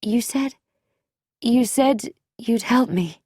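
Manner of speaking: mildly fearful, faltering appeal; frightened. A narrator's reading. Trembling visitor reminds Bobby of his promise, mildly frightened. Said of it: "held a terrified question".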